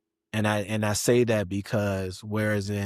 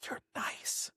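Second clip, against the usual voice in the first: soft breathy whisper; 13 dB quieter than usual. Shown as a demonstration. Man showing how quiet he is when nice, whispered. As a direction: drop to a whisper to show gentleness. Contrasting his nice and mad sides.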